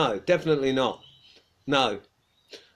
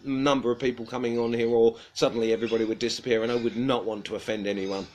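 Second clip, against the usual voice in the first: normal talking voice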